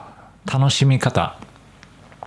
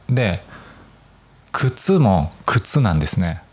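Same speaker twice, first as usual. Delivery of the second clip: ordinary talk, nothing marked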